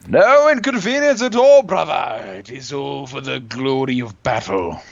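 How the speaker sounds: hammed-up battle cry to a brother-in-arms; mock-heroic warrior. Mock-heroic reply to an apology.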